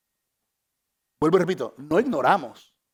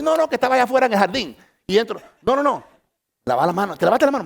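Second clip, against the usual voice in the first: higher, louder, fast nervous nagging; fretting wife; 6 semitones higher, 4 dB louder, faster than usual. Retold or imitated. Husband imitating his wife demanding he wash his hands: high fretful nagging.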